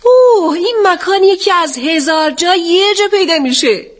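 high, delighted-sounding outcry; crying out. A narrator's reading. Woman exclaiming over the place she found, crying out. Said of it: "اون فریاد زد".

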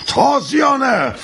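high, slow barked command; shouted order. Acted. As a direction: call for the whip. Demands the whip.

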